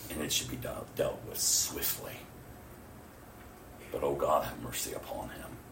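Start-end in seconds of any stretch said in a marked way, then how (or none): 3.9-5.6 low, soft pleading prayer; hushed prayer. Retold or imitated. Praying for the mother's boyfriend, hushed. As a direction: pray it low, sinking toward a whisper.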